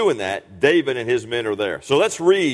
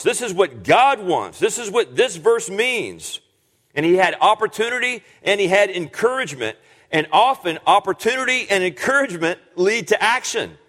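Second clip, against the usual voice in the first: preacher pressing home the lesson of restraint to the congregation; preaching; 7 semitones higher than usual. Acted. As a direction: build the sermon to full force. Preaching climax at full force.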